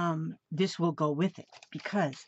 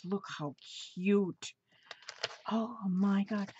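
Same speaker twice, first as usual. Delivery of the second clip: ordinary delivery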